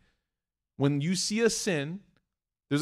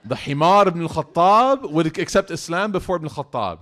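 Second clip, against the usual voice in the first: loud, emphatic punchline delivery; booming start, easing toward the end; punchline banger; 2 semitones higher, 9 dB louder than usual. Shown as a demonstration. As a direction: belt out the zinger. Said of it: "in Arabic, it's a banger".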